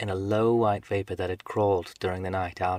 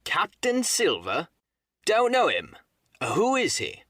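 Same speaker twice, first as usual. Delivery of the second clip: high, scornful shouted questions; crying out; 8 semitones higher, 4 dB louder, slower than usual. A narrator's reading. Captain answers the mutineers, scornful high cry. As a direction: call back in disbelief, pitched high. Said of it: "cried the captain".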